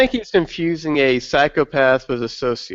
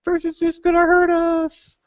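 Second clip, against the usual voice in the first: much higher, panicky mock villager; hammed-up terrified peasant; scared villager. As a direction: squeal like a frightened villager staring at the woods. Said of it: "scared villager".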